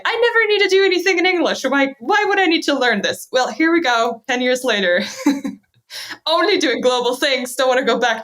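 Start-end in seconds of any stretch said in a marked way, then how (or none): 0.1-3.3 higher, scoffing voice of her younger self; retold school attitude. Younger self in school brushing off English, mock higher scoff. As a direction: toss it off like a bored schoolgirl. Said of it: "And I was in school like".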